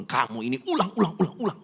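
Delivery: mock exasperated snap, pitch jumping up; retold scolding. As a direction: imitate a mildly irritated telling-off. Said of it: "saya bentak sama dia". (pura-pura membentak dengan kesal)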